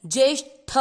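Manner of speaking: ज्येष्ठ with a hard hit on the ठ; ज्येष्ठ, ठ struck firmly; stress on ठ. Shown as a demonstration. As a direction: punch the final ठ in ज्येष्ठ. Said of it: "ठ पे थोड़ा सा आघात लगता है".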